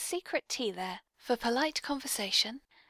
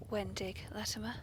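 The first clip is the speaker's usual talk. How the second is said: whispered correction; 3 semitones lower, 10 dB quieter than usual. Acted.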